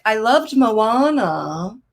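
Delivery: put-on slow, singsong kid voice; imitating her little daughter; daughter quote. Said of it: "she's just like".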